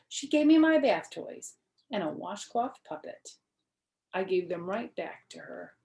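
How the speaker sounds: usual speaking voice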